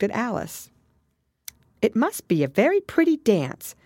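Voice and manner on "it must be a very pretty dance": put-on high, tentative little girl; a touch timid. A narrator's reading. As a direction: venture a polite remark in a higher voice. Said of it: "said Alice timidly".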